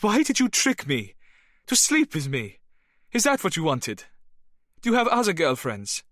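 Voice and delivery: high, loud accusing cries; angry. Acted. Bernadette accuses the lying spy, in loud angry questions. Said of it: "She was suddenly angry".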